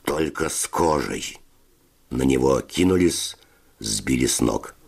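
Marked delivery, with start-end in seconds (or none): none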